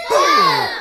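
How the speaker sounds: piercingly high, very loud boo; explosive spooky screech; scare scream. Acted. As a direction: shriek it to make them jump. Lance's terrifying scare.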